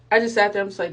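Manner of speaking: normal talking voice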